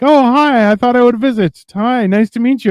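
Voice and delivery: lightly voiced keen neighbour saying hi; mock eager visitor. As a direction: play a sweet eager woman dropping by. Women visiting, voiced high.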